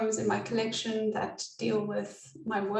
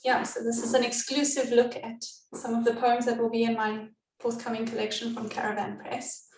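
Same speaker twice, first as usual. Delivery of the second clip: usual speaking voice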